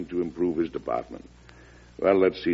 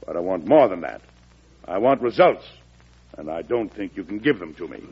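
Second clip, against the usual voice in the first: normal talking voice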